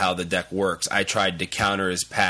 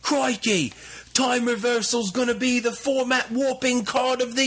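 put-on over-the-top Australian accent, much higher; a cartoon Australian hyping a card, pitched way up; bad Australian accent. Hyping Time Reversal for listeners in a bad Australian accent. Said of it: "God, that's horrible".

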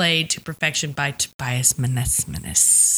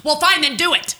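husband's retort; 10 semitones higher, 4 dB louder than usual. Retold or imitated. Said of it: "And he goes".

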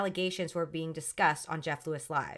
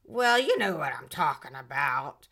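lower, slurred knowing tone; mock drunk voice; 6 semitones lower than usual. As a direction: play the tipsy woman insisting. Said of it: "She's like".

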